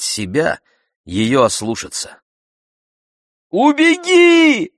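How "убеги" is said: very high, long, loud scream. A narrator's reading. Girl urges escape, screaming. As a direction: scream it out, stretching the word. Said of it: "завопила Заринка".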